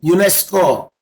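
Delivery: loud "unesco", pronounced like a name; said as one word. Shown as a demonstration. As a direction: say "unesco" firmly as a word, not as letters. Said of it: "I pronounced each first word in one word".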